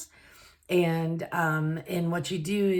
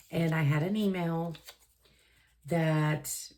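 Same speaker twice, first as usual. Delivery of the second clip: normal talking voice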